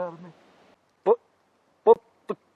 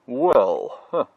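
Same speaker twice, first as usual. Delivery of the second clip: ordinary talk, nothing marked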